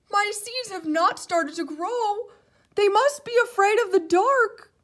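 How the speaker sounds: high, loud fussy Toad; upset Toad character voice. A narrator's reading. Toad's voice in story.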